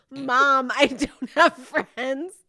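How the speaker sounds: loud, slowed line ending on a rise; declaimed slogan. Acted. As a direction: draw it out plaintively, announcing a slogan. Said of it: "the shirt I need to make".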